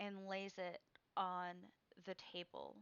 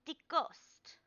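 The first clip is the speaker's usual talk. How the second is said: put-on high, loud old woman proclaiming; old crone seer; 6 semitones higher, 10 dB louder than usual. Crone reading Shadow's card, loud and high. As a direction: cry out each card like a mystic crone.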